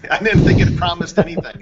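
answering the host's joke with a real laugh. Real, not acted. Answering the host's Obama beer joke, laughing.

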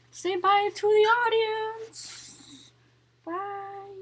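high, soft sing-out to a pet; cutesy pet talk. Pretend. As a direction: coo it high, fading very soft. Speaking for or to the pet.

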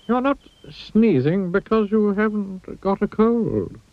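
slow high wizard voice; a slow, knowing old wizard; wise old wizard. Acted. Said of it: "a wise old wizard".

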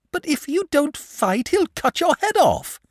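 put-on very high, alarmed boyish cry; gasping in alarm. A narrator's reading. Boy gasping at the thought of losing his friend. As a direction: blurt it out in fright. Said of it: "gasped the boy".

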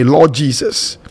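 ordinary talk, nothing marked

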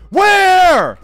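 high, loud, frightened cry; panicked shout. Real, not acted. Player hunts for the monster, frightened high shout. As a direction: yell it out in fear.

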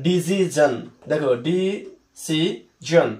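"ডিসিশন" said slowly, each syllable drawn out; correct pronunciation. Shown as a demonstration. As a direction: spell out "ডিসিশন" slowly and clearly. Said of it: "এই ডিসিশন শব্দটার সঠিক উচ্চারণ হলো". ("ডিসিশন" ধীরে, স্পষ্ট উচ্চারণে)

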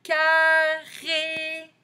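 Carré high and loud, both syllables held long; drawn-out syllables. Shown as a demonstration. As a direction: sing out CAR, RÉ slowly. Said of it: "dans le deuxième".

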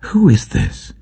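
sudden high, frightened voice; terrified question. A narrator's reading. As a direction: ask it in startled awe, voice leaping up. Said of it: "They were terrified and asked each other".

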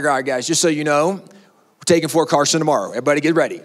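low, offhand pastor voice; casual pastor announcement. Pretend. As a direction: drop low and announce it matter-of-factly. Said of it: "If Pastor Mark gets up and he's like".

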